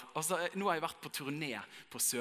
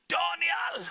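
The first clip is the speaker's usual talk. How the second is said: calling out; 10 dB louder than usual. Retold or imitated. Girl calls out in underpass.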